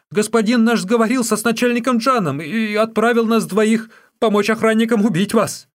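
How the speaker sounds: high, loud, stammering confession; terrified captive. A narrator's reading. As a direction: blurt it out in fear for your life.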